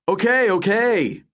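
slow, high-pitched placating. A narrator's reading. Ray tries to settle his shouting friend, placating. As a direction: draw it out to calm someone.

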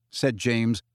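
usual speaking voice

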